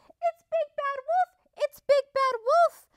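high-pitched alarmed goose; crying goose. A narrator's reading. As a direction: cry out a loud, squawky warning. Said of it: "cried Missus Goose".

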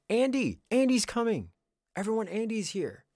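thrilled high squeal of welcome; excited. Real, not acted. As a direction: call out the arrival, delighted. Excited as a friend arrives.

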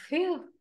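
high, loud "phew"; acted sigh of relief; relieved phew. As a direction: let out a high puff of relief. Relief at nearly dropping acorn.

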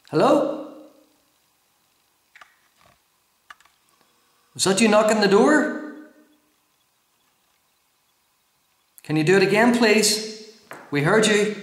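loud, high questioning call. Real, not acted. Investigator asking a spirit to knock on the door, calling out. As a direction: call out to whoever is beyond the door.

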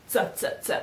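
"z" as a sharp voiceless ts, three times; correct Z sound. Shown as a demonstration. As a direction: tick out "z, z, z" as ts, no voice. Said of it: "This is not this kind of Z".

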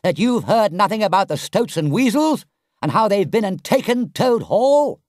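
high, agitated shout. A narrator's reading. Rat tells Toad the Hall is taken, shouting. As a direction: shout the news in disbelief, high. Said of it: "shouted the rat".

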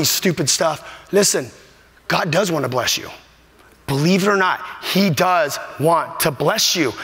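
usual speaking voice

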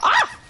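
short, high-pitched yelp; a quick high "ah" of alarm; startled yelp. Real, not acted. Sudden yell during play.

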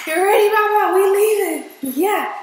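high, loud excited dog talk; pumped up, cheering the dog. Real, not acted. Hyping up the dog to leave.

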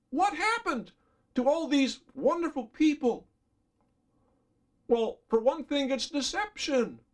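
high, impassioned appeal to the flock; fervent preaching. Acted. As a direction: plead with them, voice lifted high. Preaching climax, high and loud.

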